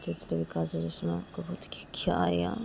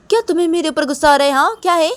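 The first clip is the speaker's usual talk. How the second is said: indignant challenge; 10 semitones higher, 16 dB louder, faster than usual. Acted. Acted quarrel, Kajal challenges her.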